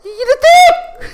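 a sudden outraged cry at him; angry shout. Acted. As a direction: yell his name, high and loud. Furious at Rithik in game.

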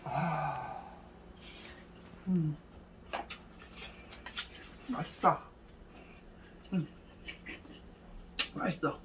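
chewing noodles while talking; speaking between bites; mouth full. Real, not acted. Seen 1: Puffed cheeks, closed working mouth after slurping noodles, chopsticks in hand.

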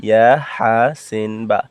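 ordinary delivery

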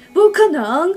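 high, loud cry of disbelief; shocked disbelief. Retold or imitated. Wang Yan refusing to believe his dad left, loud shock. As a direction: blurt it out like you refuse to accept it. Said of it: "they're like".

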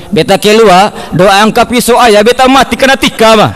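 ordinary talk, nothing marked

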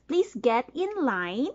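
ordinary delivery

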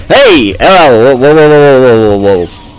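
loud, startled, high whoas fading out. Real, not acted. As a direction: react to a sudden shock. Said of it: "That was a surprise".

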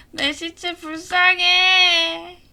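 drawn-out high mock lament; mock wail. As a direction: wail it slowly like mourning. Wails over own corpse. (과장된 울부짖음)

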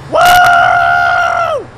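loud high yell; triumphant whoop. Real, not acted. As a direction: whoop it out as you race off. Speeding off after the fight.

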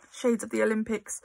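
ordinary delivery

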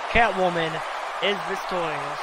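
excited live play-by-play calls; play-by-play commentary. Acted. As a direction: call the match like a ringside commentator.

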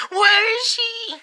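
high, loud, drawn-out cry; frantic yell. Acted. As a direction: yell desperately for a missing sweetheart. Luigi frantic over losing Daisy.

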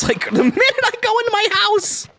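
high, laughing exasperation; laughing complaint. Real, not acted. The player complaining at the nagging villager. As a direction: laugh it out in disbelief.

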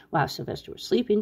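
plain reading voice

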